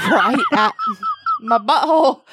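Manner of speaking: can't get it out for laughing. Real, not acted. Telling the host about labor pains, laughing through it.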